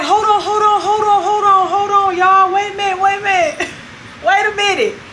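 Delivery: hammed-up plea to settle down; mock pleading. The man calming angry women.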